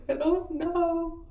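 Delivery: high, drawn-out mock wail; re-enacted tearful helplessness; imitated crying. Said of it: "I'm just hysterically crying".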